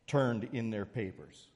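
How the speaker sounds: ordinary talk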